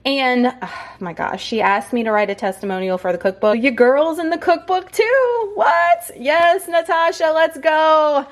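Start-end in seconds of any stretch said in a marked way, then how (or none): 3.6-8.2 high, slow at first, then a bright excited squeal; thrilled squeal. Real, not acted. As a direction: celebrate being in the cookbook, pitch way up. Proud of her testimonial inclusion.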